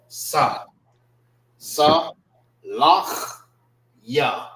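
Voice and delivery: Sa alone, then Sa, Lach, Ya spaced out; syllable by syllable. Shown as a demonstration. Teacher sounding out the word, separated Sa, Lach, Ya. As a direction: gaps between pieces, rasped end on Lach. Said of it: "This is pronounced by itself".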